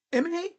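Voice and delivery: "Amai" as "IM-uh-nay", high and questioning; puzzled high attempt at "Amai", like "im-a-nay"; imitated mispronunciation. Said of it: "people try to say, like".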